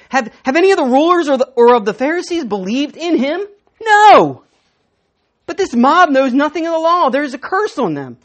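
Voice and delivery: loud, contemptuous shout of the Pharisees; scornful outburst. Retold or imitated. Pharisees scorning the crowd to the guards, loud contempt. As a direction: bellow NO with disdain. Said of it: "In verses forty-eight and forty-nine".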